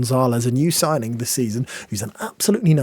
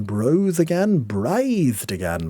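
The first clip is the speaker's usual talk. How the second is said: slow emphatic; much slower than usual. Real, not acted.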